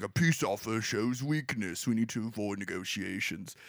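low wartime-leader impression, measured and grave; Churchill. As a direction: sink into a deep, deliberate orator's voice. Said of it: "obviously I'm doing the voice for Churchill".

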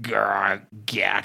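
low mock zombie grr and gack; lightly voiced zombie noise; zombie growl. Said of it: "you hear the zombie".

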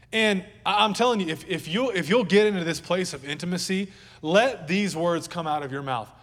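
urging the church, voice lifted; preaching push. Acted. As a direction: press it on them like a preacher.

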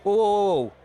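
security guard. Acted. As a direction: stop someone as a shop guard would. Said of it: "the security guard comes over and he goes".

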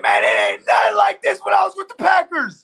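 high, loud, hammed-up shouting; over-the-top imitation of a hyped speech; mock fired-up Jamal. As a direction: yell it like a player ranting in the locker room. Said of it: "He's like".

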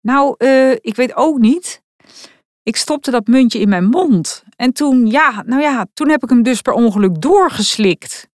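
lightly voiced boy confessing in bits; embarrassed son owning up; sheepish teen son. A narrator's reading. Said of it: "Schoorvoetend kwam zoon Stag".

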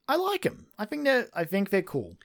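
sudden high excited burst. Real, not acted. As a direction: jump up high with delight. Sudden enthusiastic approval.

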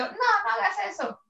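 mock-shout a reprimand at the dog; high-pitched pretend telling-off; mock yell. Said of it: "le grito".

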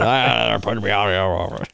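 hammed-up grumbling impression, words slurred together; Kent Peach. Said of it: "You do do it better".

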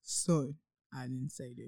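soft, low God impression. Cohost asked to do God's voice, low and understated. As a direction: drop down low and quiet to play God. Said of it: "Do his voice".

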